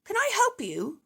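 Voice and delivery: sweet, eager seller; shopkeeper voice. A narrator's reading. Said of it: "says Mister Fox".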